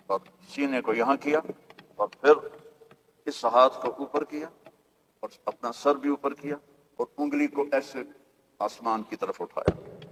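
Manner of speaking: hushed, soft storytelling that drops to a near murmur. A narrator's reading. Preacher hushing as he tells of the newborn's prostration, soft and awed. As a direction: draw the listeners in with a soft, awed voice.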